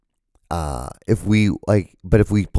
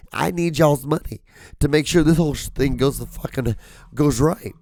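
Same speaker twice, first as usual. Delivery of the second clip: pretend owner rant; 8 semitones higher, 4 dB louder than usual. Ranting as pretend team owner.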